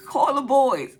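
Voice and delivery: loud, high, crying plea; mock panic. Asking her partner to call for help, loud crying plea. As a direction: beg in hammed-up panic.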